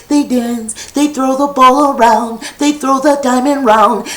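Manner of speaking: ordinary delivery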